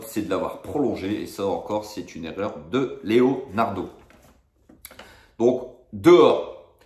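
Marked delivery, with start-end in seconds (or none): 5.4-6.8 drawn-out, loud final verdict; slow loud verdict. Real, not acted. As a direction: slam it down slowly. Verdict lent et appuyé. (verdict lent et fort)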